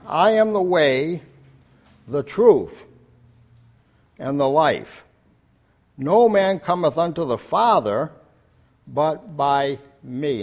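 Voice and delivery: slow, loud, weighty reading of Christ's words; solemn scripture quote. Acted. Preacher reciting Jesus' words to the congregation, solemn and slow. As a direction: proclaim each phrase slowly.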